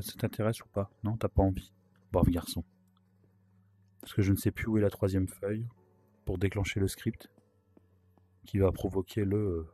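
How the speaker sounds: ordinary talk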